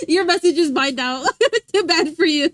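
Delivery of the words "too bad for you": playful gloat through laughter; giggly mock sympathy; laughing taunt. Real, not acted. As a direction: tease them while cracking up. Laughing while claiming someone's message.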